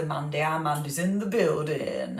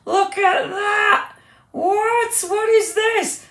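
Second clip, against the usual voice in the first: much higher and louder, slow astonished cry; amazed shout; 5 dB louder, much slower than usual. Real, not acted. Host amazed showing viewers the spinning background. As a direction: gasp it out in wonder.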